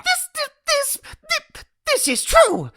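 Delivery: high, loud stammering agreement; flustered stammer. Acted. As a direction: trip over the words in excitement. Flustered agreement after the news.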